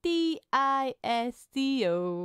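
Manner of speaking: letter by letter, drawn out; spelled out slowly. Shown as a demonstration. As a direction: spell it out for effect. Spelling disco out playfully.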